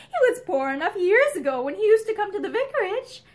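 amused. A narrator's reading. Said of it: "Pansy laughed".

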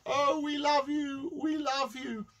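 high, drawn-out gushing coo; mock adoring fans. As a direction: gush in a high, stretched-out voice. Mimicking adoring fans of saviour.

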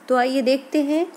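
ordinary delivery

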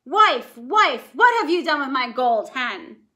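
loud roaring ogre. Acted. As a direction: bellow for his wife in rage. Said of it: "He heard him roaring".

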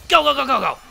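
high, loud rapid-fire go's; frantic race-start yell; urgent cheering. Real, not acted. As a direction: urge them on at full volume.

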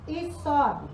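ordinary delivery

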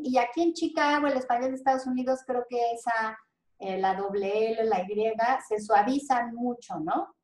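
normal talking voice

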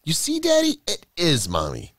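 starts very high, settles onto a firm finish; little girl's triumphant told-you-so; triumphant child. A narrator's reading. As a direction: crow it to Daddy, proved right. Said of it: "cried triumphantly".

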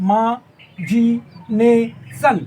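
"মা জি নে সন" one syllable at a time; split into syllables. Shown as a demonstration. Teacher breaking up imagination, "মা জি নে সন" separately. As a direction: clear pauses between "মা", "জি", "নে", "সন". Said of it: "সিলেবেলে ভাগ করে নিতে".

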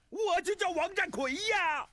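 high, drawn-out exclamation of amazement; astonished revelation. Acted. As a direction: blurt out the coincidence, stretching it high. Startled discovery of lost brother's name. (惊讶地高声拖长)

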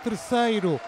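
loud goal call, then stretched; fast dramatic football commentary. Real, not acted. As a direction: shout the goal, then slow down.

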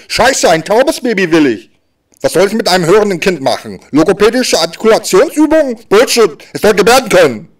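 indignant, high rant gaining force; angry outburst. Acted. Deaf father ranting at the doctor over his hearing baby, outraged. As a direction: rant in outrage, pitch climbing toward the end.

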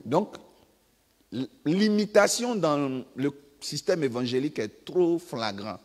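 normal talking voice

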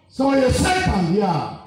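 preaching shout. Acted. Preaching climax on Satan.

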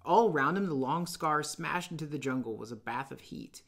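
usual speaking voice

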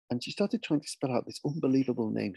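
usual speaking voice

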